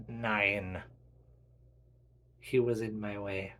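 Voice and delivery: put-on low, cold German accent; German villain. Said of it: "you demented German man".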